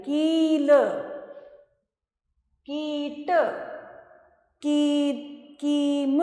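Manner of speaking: की stretched long in each word; drawn-out long vowel. Shown as a demonstration. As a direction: pull out the ee of कील, कीट, कीम. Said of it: "खींच के बोली जाती है".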